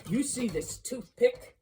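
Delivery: Scrooge challenging. Acted. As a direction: thrust it at him a little nervously.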